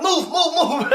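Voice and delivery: high, frantic mock urgency; urgent shoving command. Acted pushing her out.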